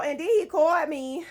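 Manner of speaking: ordinary talk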